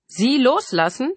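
high, drawn-out incredulous question. A narrator's reading. Killer answers the mother's plea, incredulous. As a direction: echo the request slowly in disbelief. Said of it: "fragte er ungläubig".

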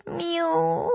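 drawn-out high cat meow; peevish, stretched-out miaow; grumpy meow. A narrator's reading. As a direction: imitate a sulky cat, long and high. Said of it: "ein sehr deutliches, sehr verdrießliches".

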